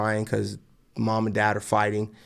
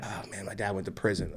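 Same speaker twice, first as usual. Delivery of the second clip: low-key, much softer reluctant confession; embarrassed admission; 3 semitones lower, 10 dB quieter than usual. Retold or imitated. Kid asked about his dad, embarrassed admission. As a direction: drop the volume as if ashamed to admit it.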